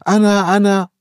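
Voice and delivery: put-on high, loud kids shouting; shrill chorus of kids screaming me me; deafening shout. A narrator's reading. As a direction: yell it like excited children. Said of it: "يصيح الأطفال بصوت يصم الآذان".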